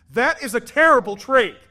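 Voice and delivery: put-on high, loud kid voice; son's outraged verdict. Son judging the trade, high loud kid voice. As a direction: pronounce it a disaster in a kid's voice. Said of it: "And he goes".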